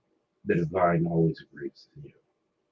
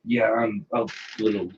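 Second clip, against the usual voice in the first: tipsy; 10 semitones higher than usual. Real, not acted. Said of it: "I'm a little tipsy at the moment".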